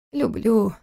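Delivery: wistful, drawn-out admission; understated longing in the answer; melancholy. A narrator's reading. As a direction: admit it with a touch of anguish. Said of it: "с тоской ответил сын".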